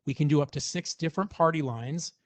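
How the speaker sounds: usual speaking voice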